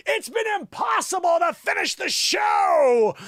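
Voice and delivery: high, slow, loud melodramatic cry; mock-anguished wail. Host acting out [name]'s apology, melodramatic wail. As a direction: wail it out, stretching the last word.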